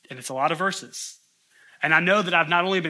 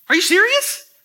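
incredulous; 8 dB louder than usual. Real, not acted. Preacher's disbelief at Lot.

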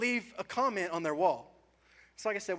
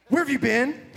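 loud, high demanding dad; mock dad catching a teenager out late; stern dad; 7 semitones higher, 10 dB louder than usual. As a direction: bark it like a dad at midnight. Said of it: "sounds like you're a dad of a teenager".

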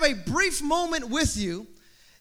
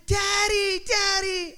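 put-on child shouting for his father, much higher; excited child; 7 semitones higher, much slower than usual. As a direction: yell for daddy like an eager little boy. Son running to dad.

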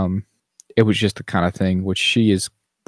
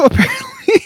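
word laughed out much higher; laughing; 4 dB louder than usual. Real, not acted. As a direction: say it through a laugh. Laughing at the parents' logic.

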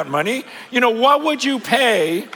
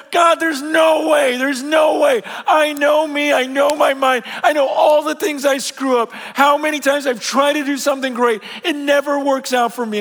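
louder and higher, protesting; loud pleading protest; 6 semitones higher, 3 dB louder than usual. Acted. Believer loudly protesting to God, full of doubt. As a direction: cry out your doubts to God.

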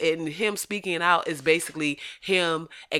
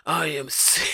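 mock remorseful apology voice. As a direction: read the apology like a sheepish retiree. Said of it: "That's why I gave him that voice".

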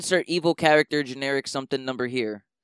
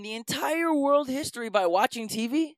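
high-pitched mock disbelief; indignant, raised-pitch scoffing; incredulous; 10 semitones higher than usual. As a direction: question them in comic exasperation. Incredulous address to the villain.

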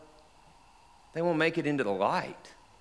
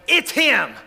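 loud, high cry of recognition; joyful yell from the boat; excited shout; 9 semitones higher, 9 dB louder than usual. Acted. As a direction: shout it out across the water. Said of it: "I shouted".

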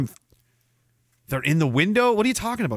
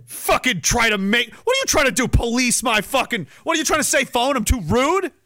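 mock indignant yell; 7 semitones higher than usual. Real, not acted. Yelling at the phone.

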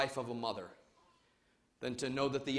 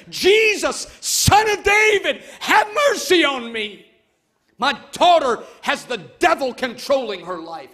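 very high, loud crying plea; emphatic desperate plea; 10 semitones higher, 13 dB louder than usual. Acted. Mother crying out to Jesus for her daughter, desperate. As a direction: beg from your innermost being. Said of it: "emphatic plea and a cry".

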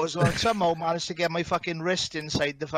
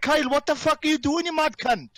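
high, raised voice of disbelief; retold exasperated shout; 8 semitones higher, 3 dB louder than usual. Friend confronts Kyle for trying to drown himself, exasperated shout. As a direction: re-enact yelling at a mate in alarm.

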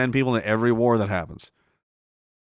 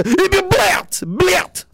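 high, loud Russian swear; mock Russian curse; 6 dB louder than usual. Playing Putin checkmating you, shouted Russian curse. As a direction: spit it out like a Russian. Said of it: "And I go".

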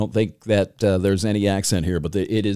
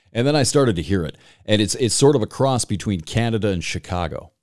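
ordinary talk

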